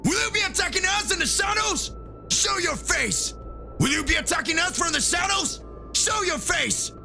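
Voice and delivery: high shouted challenge; shouting Goku. Acted. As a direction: yell up at the shadows. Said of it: "So Goku says".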